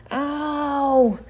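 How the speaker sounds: high, shrill-pitched ouch; squeal of pain. A narrator's reading. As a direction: yelp like a punctured bully. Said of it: "he squealed".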